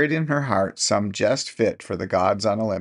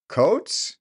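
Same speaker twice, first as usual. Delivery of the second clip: put-on high, quavering old woman's question; quavered; 9 semitones higher than usual. A narrator's reading. Deaf old woman answering her husband, high quaver. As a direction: let the word quaver upward. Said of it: "she quavered".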